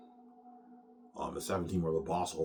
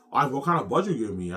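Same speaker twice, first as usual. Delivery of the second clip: louder, higher and fast, keen to hear the number; eager Peter question; 8 semitones higher, 11 dB louder, faster than usual. Retold or imitated. Peter asks Ivan about the budget, eager and loud. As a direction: rush it out like a director hungry for cash. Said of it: "He's all happy".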